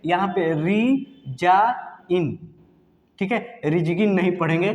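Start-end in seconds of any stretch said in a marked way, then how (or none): 0.7-2.5 री जा इन slowly, syllable by syllable, no g; broken into syllables. Shown as a demonstration. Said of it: "जी को pronounce नहीं करेंगे". 3.7-4.1 रिजगिन with a hard g before "in"; g wrongly sounded. Shown as a demonstration. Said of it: "गलत हो जाएगा".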